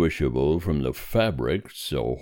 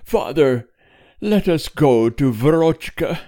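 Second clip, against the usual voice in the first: high, louder tearful entreaty; tearful plea; 12 semitones higher, 6 dB louder than usual. A narrator's reading. Wife begging her husband to see their daughter, tearful plea. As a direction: plead with him, high and upset. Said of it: "managed to say between her sobs".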